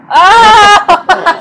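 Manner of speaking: loud high yelp of delight; excited shout. Real, not acted. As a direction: let out a loud happy yelp. Excited guessing game.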